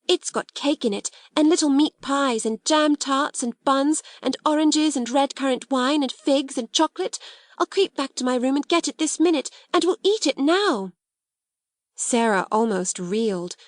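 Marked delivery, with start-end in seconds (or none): 0.0-10.9 eager, higher-pitched listing of treats; breathless delight over a secret feast; excited child. Acted. Ermengarde tells Sarah about her treats, excited.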